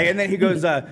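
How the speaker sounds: ordinary talk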